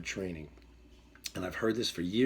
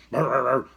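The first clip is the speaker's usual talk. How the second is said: loud mock dog barks; dog noises done by voice; imitated dog barking; 3 semitones higher, 11 dB louder than usual. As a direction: bark back like a chased dog. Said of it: "And he's".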